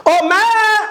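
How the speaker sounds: high, held call on "mé"; calling out the syllable. Shown as a demonstration. Teacher showing how the tonic syllable stands out, calling out. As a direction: holler it so "mé" stands out. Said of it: "Como ele se sobressai".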